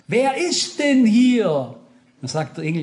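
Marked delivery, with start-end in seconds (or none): 0.1-1.8 slow, raised outcry of mock horror; acted utter dismay. Dreamer asks the angel at heaven's edge, utterly appalled. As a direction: imitate an appalled question, higher and drawn out. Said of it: "Dann fragt er völlig entsetzt".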